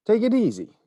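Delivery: a friendly warning from behind the counter; shopkeeper voice. A narrator's reading. Shopkeeper warns boy eating.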